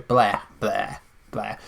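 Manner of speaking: "bleh" as one short syllable, repeated; trying pronunciations. Shown as a demonstration. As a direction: test out "bleh" with an open eh, word by word. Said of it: "maybe that's how you pronounce it".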